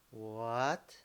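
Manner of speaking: "What?" drawn out, gliding upward; slow questioning rise on "What?"; rising second tone. Shown as a demonstration. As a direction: start "What?" low and glide up. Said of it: "Raising your tone".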